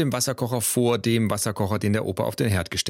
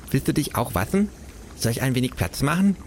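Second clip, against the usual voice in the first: higher, eager raccoon voice; friendly raccoon; 4 semitones higher than usual. Acted. Raccoon offering space, friendly higher voice.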